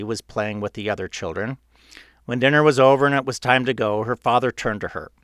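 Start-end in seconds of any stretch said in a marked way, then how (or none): none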